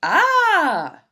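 sudden high, loud little-monster shriek; glurb scream. Acted. Reader shows how loud a glurb screams, high shriek. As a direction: let out a sharp monster yelp. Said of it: "Glurb screams pretty loud".

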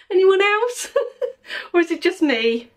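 high laughing question. Real, not acted. Admitting she's clingy, laughing out loud. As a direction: giggle through the words.

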